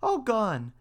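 high mouse voice; mouse character. A narrator's reading. Mouse blurts the last name to cat, high-pitched. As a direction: let it slip out in a high mouse voice. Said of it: "was already on the poor mouse's lips".

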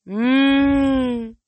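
long, loud, high moo through closed lips; lowing moo. A narrator's reading. Bessie the cow serpent hears of the sacrifice, lowing. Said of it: "Bessie lowed".

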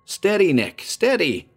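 calm him down firmly; cautioning. Acted. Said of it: "Danny Lomax cautioned".

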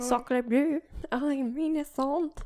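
put-on French accent. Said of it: "But in a French accent".

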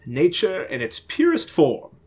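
slow, lofty, high-pitched declaration; grandiose ghost. Acted. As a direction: savor every word like a poet.

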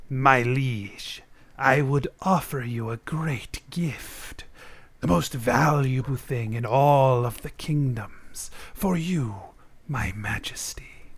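put-on slow, whispery old man; hushed, unvoiced courtly wheedling; sinister old enchanter. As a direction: whisper the offer slowly to a king. Hunched old enchanter addresses the king.

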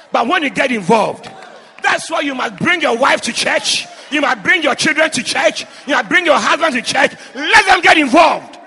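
loud preaching cry to the church; preaching at full force. Acted. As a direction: urge the congregation loudly.

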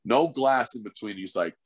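usual speaking voice

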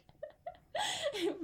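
loud laugh. Real, not acted. Cracking up at the review.

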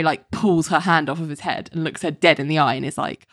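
ordinary talk

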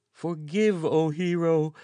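high, loud, frightened plea; groveling cry. A narrator's reading. Groveling courier crying to the hero for forgiveness. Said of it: "cried the groveling courier".

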